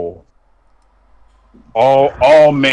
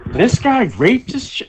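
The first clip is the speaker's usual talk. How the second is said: shocked disbelief; 14 semitones higher than usual. Retold or imitated. Reacting to accusation.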